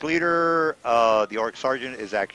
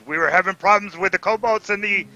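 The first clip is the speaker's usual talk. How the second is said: higher, louder gnoll chieftain; gnoll leader; 5 semitones higher, 5 dB louder than usual. Acted. Gnoll leader opening talks with the others, raised creature voice. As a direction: address the gathering as gnoll leader. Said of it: "gnoll leader says".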